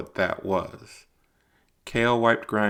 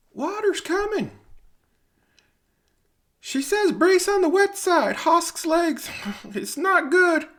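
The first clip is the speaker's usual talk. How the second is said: much higher, thin voice calling up from the shaft, slowing at the end; a far-off miner shouting news up the mine shaft; distant voice from below; 5 dB louder than usual. Acted. Rescuer below calls up warning about Hosk's legs, high and distant.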